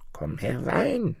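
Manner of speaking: high, soft, slow crone; old forest woman. A narrator's reading. Ancient bush mother invites boy inside, high soft coaxing. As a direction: lure the visitor in softly, high and drawn out. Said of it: "sagte die Alte".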